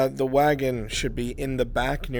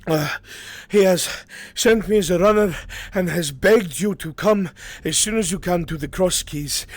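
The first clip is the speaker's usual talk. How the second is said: higher-pitched, pressing appeal, gasping for air; breathless urgent messenger; 4 semitones higher than usual. Acted. Runner begging the lord to come at once, winded. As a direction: plead urgently through short breath.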